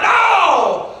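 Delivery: high, drawn-out pleading holler. Acted. Dreamer crying to his mother as he hangs, hollering. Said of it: "the whole time he's hollering".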